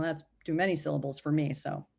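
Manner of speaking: ordinary delivery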